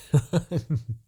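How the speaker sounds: short gloating laugh; evil chuckle. Acted. Demon king chuckling over his perfect pawn. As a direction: chuckle at your own cleverness.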